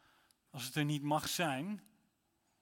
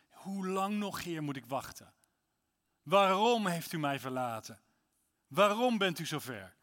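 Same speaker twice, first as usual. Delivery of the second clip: a hint of a pleading cry, louder on each "Waarom"; 2 semitones higher, 4 dB louder than usual. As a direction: echo the psalm's lament. Voices the believer's cry. (een vleugje smekende klacht)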